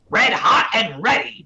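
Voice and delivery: slow, high game-character catchphrase; Blaine. Acted. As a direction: announce it big like a gym leader. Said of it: "my absolute favorite is Blaine".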